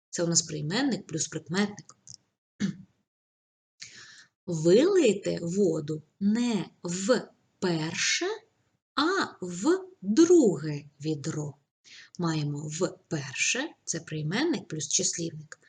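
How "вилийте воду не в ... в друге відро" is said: slow, even dictation pace; measured dictation voice. Shown as a demonstration. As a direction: read it out slowly for writing. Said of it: "dictating sentence".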